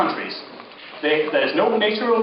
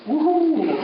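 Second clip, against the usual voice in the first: high-pitched whoop; mock cheer; 8 semitones higher than usual. Cheering the Nobel Peace Prize, high mock whoop. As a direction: give a short raised celebratory whoop.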